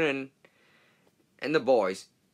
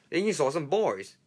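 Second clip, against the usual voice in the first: ordinary delivery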